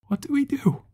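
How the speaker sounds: high, loud, frightened cry of a follower; panicked shout. A narrator's reading. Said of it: "one of them shouted".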